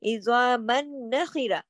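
"इज़ामन नखिरा" slowly, with a long doubled n; idgham into noon. Shown as a demonstration. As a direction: hold the joined n in "इज़ामन नखिरा". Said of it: "नून के तशदीद में जा रहा".

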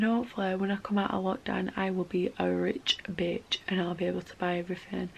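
hushed whisper aside. Real, not acted. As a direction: whisper it like a secret. Whispered joke about being rich.

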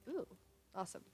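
normal talking voice

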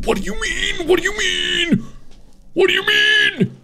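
can't believe what you're hearing; panicked high-pitched what; alarmed disbelief. Real, not acted. Repeated in rising alarm.

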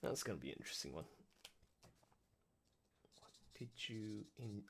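soft unvoiced whisper. Real, not acted. Host murmuring to himself while taking notes, whispered. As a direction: whisper it to yourself. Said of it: "whispering aside".

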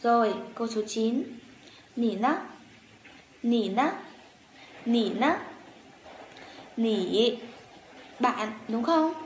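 usual speaking voice

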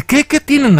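put-on the girl asking her dealer in a high alarmed voice; high frightened girl voice. Girl asks scared guy, panicking.

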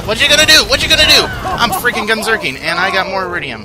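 high, loud battle taunt; taunting shout. Real, not acted. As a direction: crow at the enemy at full voice. Gloating at enemies in combat.

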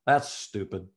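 a touch of a blunt stranger, flat dismissal; mildly mock his dismissive tone; blunt verdict. Stranger judging the tall photographer, light blunt mock. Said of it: "He goes".

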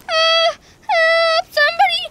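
high, loud pretend panic call; mock cry for help. Runaway rider cries out to anyone, high pretend panic. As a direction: wail for anyone to save her. Doll carried off by horse.

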